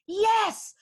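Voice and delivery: high, elated cry of sudden inspiration; excited eureka. Acted. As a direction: act out a thrilled lightbulb moment. Owner's eureka moment acted out.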